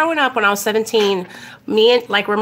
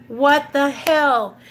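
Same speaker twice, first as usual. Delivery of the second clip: drawn-out, raised mock exasperation; pissed-off dad; 5 semitones higher, slower than usual. Dad showing daughter the pressed leaves, retold annoyance. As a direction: imitate an exasperated father, stretching it out. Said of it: "He was pissed".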